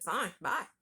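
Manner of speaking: retold irritated "Fine, bye"; curt goodbye. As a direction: hang up on him, mock irritated. Ending a tense phone call.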